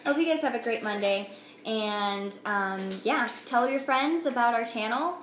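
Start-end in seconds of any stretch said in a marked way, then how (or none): none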